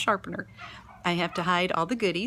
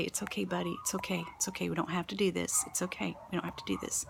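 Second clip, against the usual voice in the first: soft, gentle reassurance, easing into a whisper; calm soothing; 7 dB quieter, faster than usual. Retold or imitated. Teacher calming a frustrated little boy at the table, soft and soothing. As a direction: quietly settle a tense child. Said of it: "I just calmly say".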